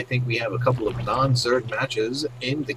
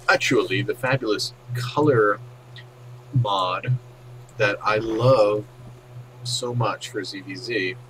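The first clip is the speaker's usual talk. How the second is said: ordinary delivery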